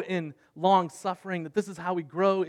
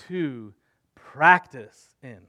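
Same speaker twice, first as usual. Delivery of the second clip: "practice" slow and much louder, drawn out; heavy emphasis on practice; 5 dB louder, much slower than usual. Shown as a demonstration. Pastor to his church, hammering home "practice" slow and loud. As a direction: lean hard on "practice in", slowly. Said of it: "Heavy emphasis, emphasis on the word practice".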